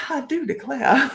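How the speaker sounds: hammed-up Southern belle, laughing; a mock Southern belle exclamation. Laughing as she mocks the Karen card in a Southern belle accent. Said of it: "only done properly in a Southern accent".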